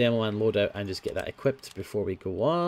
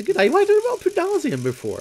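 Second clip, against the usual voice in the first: high excited; 4 dB louder, faster than usual. Real, not acted. Excited realisation.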